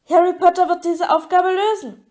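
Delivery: high, insistent elf squeak; Dobby. A narrator's reading. House-elf insists Harry will solve the task, eager squeak. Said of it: "quiekte der Elf".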